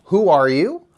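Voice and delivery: ordinary delivery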